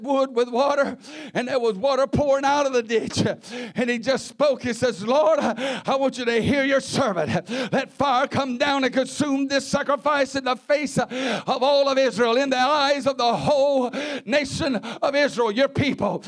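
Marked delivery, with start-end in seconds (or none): 5.0-16.2 loud, higher pleading call to the Lord; impassioned preacher's prayer; fervent preaching prayer. Acted. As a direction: cry out to God with the whole church behind you. Elijah's prayer, shouted preaching.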